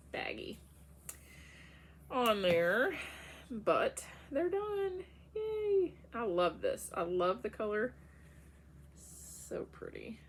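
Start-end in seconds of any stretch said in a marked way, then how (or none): 3.5-5.9 excited. Real, not acted. Celebrating finished socks.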